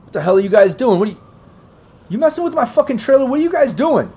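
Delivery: indignant, high-pitched yell; guard yelling over. Acted. Security guard to strangers by his trailer, yelling over. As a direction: call it across, pitch jumping right up. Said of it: "he's yelling over towards you".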